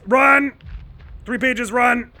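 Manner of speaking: high, loud yell to flee; panicked shout. Real, not acted. Player panicking at the chase, yelling run. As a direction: shout it as if something's right behind you.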